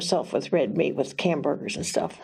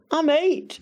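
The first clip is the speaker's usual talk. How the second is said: put-on a higher, proud little boy's "I'm eight"; 8 semitones higher than usual. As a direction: imitate the boy lightly. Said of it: "I just thought that was so adorable".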